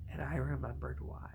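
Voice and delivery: rueful unvoiced whisper. Real, not acted. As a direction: whisper it wryly. Rueful aside.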